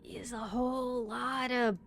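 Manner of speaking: slow overwhelmed whisper. Real, not acted. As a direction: hush it as if overwhelmed. Whispered aside about the game.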